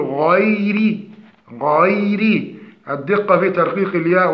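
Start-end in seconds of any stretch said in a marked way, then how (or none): 0.0-1.0 غير with a light ghayn and a thin ra; correct ghayn. Shown as a demonstration. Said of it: "فنقول". (ترقيق الغين في غير)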